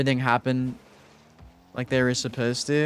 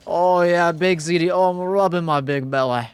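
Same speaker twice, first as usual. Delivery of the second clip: Shrek-like voice; 4 semitones higher, 4 dB louder than usual. Pretend. Streamer voicing a viewer's name in a goofy ogre voice. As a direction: ham up a big-bellied ogre. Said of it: "It's more like Shrekish".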